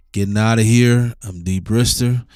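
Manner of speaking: normal talking voice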